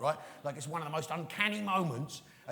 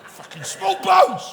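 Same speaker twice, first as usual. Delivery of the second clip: huge, high, drawn-out yell; worked-up shout; 13 semitones higher, 13 dB louder, slower than usual. Pretend. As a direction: blow up over the words, slow and very loud. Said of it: "I've set myself off".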